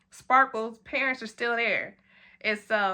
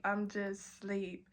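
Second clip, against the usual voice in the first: much softer, slow drowsy murmur; sleepy murmur; 3 semitones lower, 12 dB quieter, much slower than usual. Shown as a demonstration. Leaning on the car window, slow drowsy murmur. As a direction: let it fade out as if dozing off.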